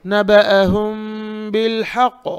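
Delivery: नबअहुम बिल हक़ with long held m and throaty ha; model recitation. Shown as a demonstration. Teacher shows "like this", model recitation. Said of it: "खौफ अच्छे से पढ़ेंगे".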